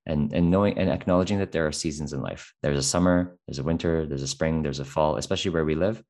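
normal talking voice

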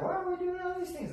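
high-pitched questioning imitation of a client; mock-bewildered squeak; puzzled client.

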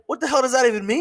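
high-pitched mock bewilderment; reenacted incredulous question; baffled. Retold baffled reply to tech.

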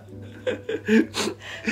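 breathy, unvoiced giggle; stifled giggles. Real, not acted. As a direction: hold back a laugh. Breaking up mid-intro.

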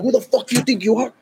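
mock-livid challenge, fired off fast; pissed-off challenge. Pissed guy demands who they think they are, mildly angry. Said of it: "Guy gets pissed".

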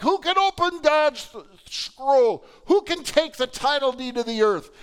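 mock crowd crying out who can; heaven's search, called out high and loud; searching voices calling. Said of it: "And they're like".

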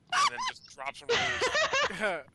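high, loud, slowed climax; excited. Real, not acted. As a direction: build to a loud high peak.

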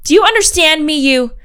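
put-on furious mom scolding an eight-year-old; angry scolding. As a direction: tell the kid off hard. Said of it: "all E M was able to squeak out".

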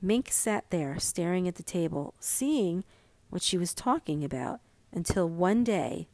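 usual speaking voice

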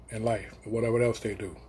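normal talking voice

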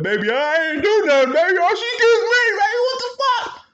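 very high, loud frantic begging; panicked pleading. Retold or imitated. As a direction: plead to his girl in a panic. Caught boyfriend pleading.

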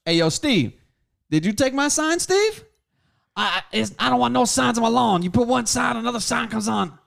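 loud, high mock yell at the neighbour; yelling at Steve across the office, loud and high; retold yelling. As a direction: shout it at him, outraged. Retold confrontation shouting at Steve.

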